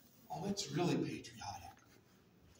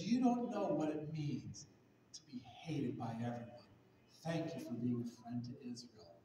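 tearful whisper; 7 semitones lower, 6 dB quieter than usual. Retold or imitated. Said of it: "in tears".

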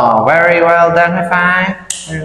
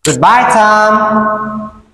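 drawn-out goodbye; 3 semitones higher, much slower than usual. Acted. Sing-song farewell to child.

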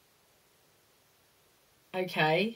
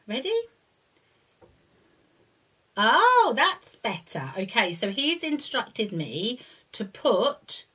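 ordinary talk, nothing marked

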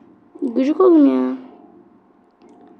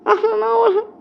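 higher, crying wail; anguished cry; 9 semitones higher than usual. Real, not acted. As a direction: cry out in despair. Anguish at conceded chance. (çaresiz feryat)

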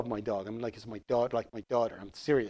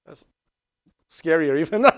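ordinary talk

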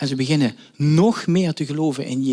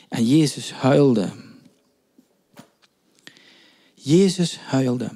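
slow emphasis; slower than usual. Real, not acted. Repeating the verse for weight.

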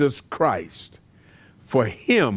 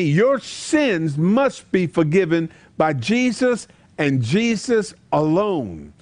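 normal talking voice

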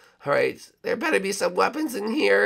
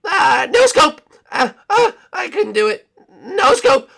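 higher, loud yelping shot; excited cry; 9 semitones higher, 7 dB louder than usual. Acted. Banana Guard firing blind, loud excited cry. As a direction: cry out as you fire.

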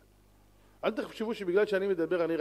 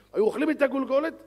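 louder, higher emphatic line; emphatic burst; 8 semitones higher, 7 dB louder than usual. Real, not acted. Preacher describing wild students, loud and high. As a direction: punch the exaggeration out.

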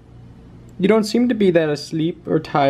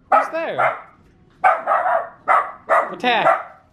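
mock watchdog; 5 semitones higher, slower than usual. Real, not acted. Mock guard-dog talk to puppy.

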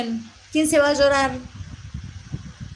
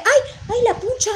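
real cry of pain; 10 semitones higher than usual. Real, not acted. Streamer scrapes her burn mid-live and yelps in real pain. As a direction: yelp out as the burn gets scraped. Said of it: "Me raspé la quemadura, me duele".

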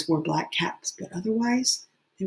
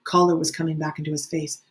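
plain reading voice

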